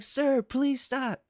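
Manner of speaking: put-on slow, high, desperate child's plea; pleading kid. Mother imitates son begging the ride guy, slow high plea. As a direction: beg the ride operator like a scared boy. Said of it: "He's like".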